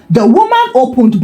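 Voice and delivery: ordinary delivery, nothing marked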